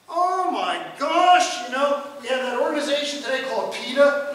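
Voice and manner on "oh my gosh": mock horror. Mock horror at killing fly.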